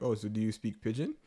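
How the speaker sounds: ordinary talk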